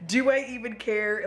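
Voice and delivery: ordinary delivery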